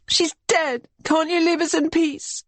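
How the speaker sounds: high, raw, grief-stricken shriek. A narrator's reading. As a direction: scream in despair. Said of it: "she shrieks".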